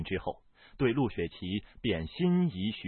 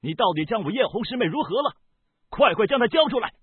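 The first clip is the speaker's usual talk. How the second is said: high, loud, rapid demand; furious, fast interrogation rising to a shout; angry demand; 12 semitones higher, 7 dB louder, faster than usual. A narrator's reading. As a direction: shout it as an urgent order. Angry confrontation, demanding a captive.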